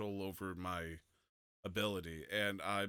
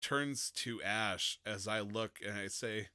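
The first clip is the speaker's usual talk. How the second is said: ordinary delivery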